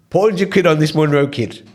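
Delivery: said through a laugh; chuckling as he places the bet; amused, laughing through the words. Real, not acted. Laughing while retelling his bet.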